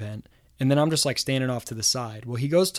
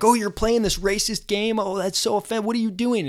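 higher, scandalised reporter voice; imitating indignant reporters; mock outrage; 7 semitones higher than usual. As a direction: play the shocked, accusing journalist. Reporters accuse him of racism.

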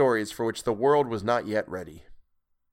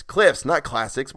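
slow, emphatic correction, Cliffs stressed; self-correction; 4 semitones higher, 5 dB louder, slower than usual. Real, not acted. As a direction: correct yourself deliberately. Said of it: "just like cliffs".

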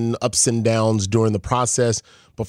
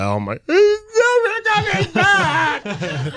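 high, slow, laughing mock lament; mock wail; slower than usual. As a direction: ham up the heartbroken dad, laughing through it. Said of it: "I'm like".

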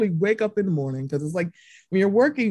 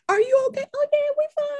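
high mock disbelief; faster than usual. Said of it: "I was like".

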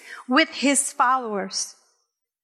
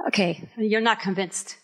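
ordinary talk, nothing marked

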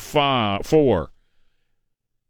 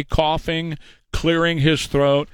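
usual speaking voice

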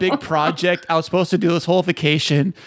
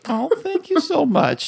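higher, slow, hammed-up teary thanks; mock Oscar winner; 8 semitones higher than usual. Birthday host thanks cohosts like an Oscar winner, mock teary. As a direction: gush like a tearful award winner.

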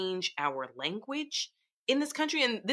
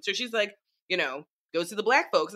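ordinary talk, nothing marked

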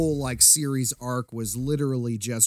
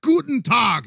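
high, mock-Germanic villain greeting; mock Germanic accent; 9 semitones higher, 3 dB louder, slower than usual. Villain greeting someone who walks in, mock Germanic accent. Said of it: "when someone walks in, they're like".

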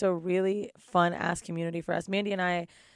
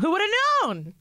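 high, rising mock amazement; teasing who-knew, pitched up; mock surprise; 11 semitones higher, 3 dB louder, faster than usual.

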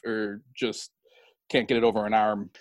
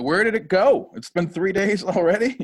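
mock dismay; 7 semitones higher, 6 dB louder than usual. Mock dismay at lost weekend.

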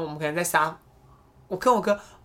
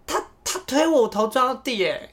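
put-on high, whiny little-kid complaint; lightly voiced tearful child tattling; 6 semitones higher than usual. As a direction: kid whining to mom that brother pushed him. Crying child complains to mom.

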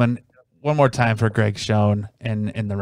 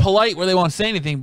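usual speaking voice

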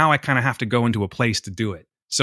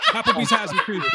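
laughing. Real, not acted. Laughing at own mixup.

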